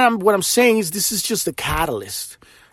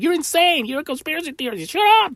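mocking dismissers; 12 semitones higher than usual. Mocking people dismissing him.

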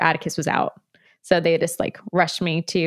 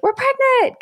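excited announcement; 4 dB louder, slower than usual. Retold or imitated. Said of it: "one of those things like".

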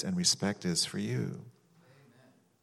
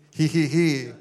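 high, loud, deliberate "hee hee hee"; put-on tittering laugh; forced giggle; 7 semitones higher, 5 dB louder than usual. Shown as a demonstration. Preacher demonstrating joy instead of moping, forced giggle. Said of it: "I'm gonna do the".

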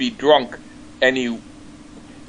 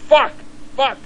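mock-angry griping, pitched up; retold anger; 8 semitones higher, faster than usual. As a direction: imitate a rejected man's outraged complaint. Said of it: "And he got really angry".

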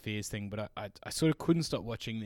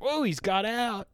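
snake keeper; 10 semitones higher, 3 dB louder than usual. Retold or imitated. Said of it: "he's like".

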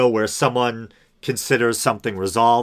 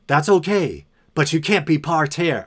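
higher, a bit louder pushy brush-off, lightly imitated; mildly aggro retort; 7 semitones higher, 4 dB louder than usual. Parking-lot stranger telling the narrator to move, lightly mock aggro. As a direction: play the stranger shooing the narrator off, half-hearted. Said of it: "kind of an aggro vibe to him".

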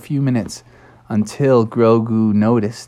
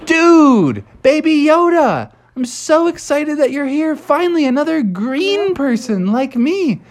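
put-on high, eager kid frog voice; excited Froggy; 13 semitones higher than usual. Froggy greets Baby Yoda, high and thrilled. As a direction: gush at your new green friend. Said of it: "And he said".

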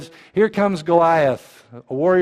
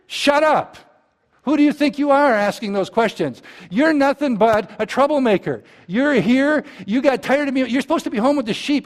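higher mock scolding; angry older brother; 8 semitones higher than usual. Older brother scolding David for coming, mock angry. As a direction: tear into the kid brother, half acted. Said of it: "his older brother goes".

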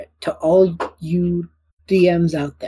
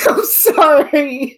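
high, slow giggly sorry; laughing apology; 9 semitones higher, 5 dB louder, much slower than usual. Real, not acted. After teasing a friend, a slow high laughing sorry.

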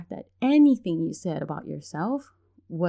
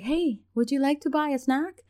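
put-on kid seller voice; 8 semitones higher than usual. Imitating kid selling snacks.

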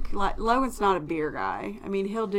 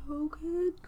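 soft, nervous call; timid knock; 7 semitones higher, 9 dB quieter than usual. Retold or imitated. Mother timidly knocking on her son's door. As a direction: call through the door scared. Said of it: "I'm like".

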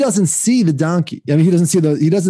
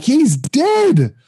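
higher, imitating a panicked parent; imitating a panicked parent: he's dead; mock alarm; 10 semitones higher than usual. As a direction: play up the alarm. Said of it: "They were like".

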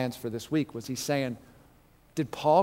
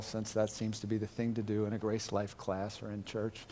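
sarcastic; 6 semitones lower, 11 dB quieter than usual. Real, not acted. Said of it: "uh, sarcasm and I".

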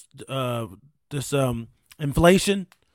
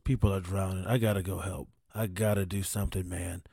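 mock earnest candidate; 8 semitones lower, 6 dB quieter than usual. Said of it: "quoting a candidate's pitch".

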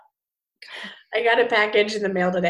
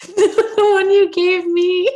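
full cheek voice; 8 semitones higher, 9 dB louder, slower than usual. Pretend. Trying the voice trick.

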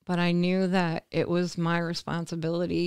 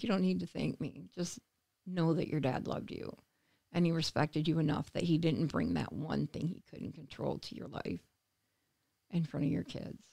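gentle comfort; 7 dB quieter than usual. Retold or imitated. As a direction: soothe her like someone who just lost her father. Comforting crying caller, retold.

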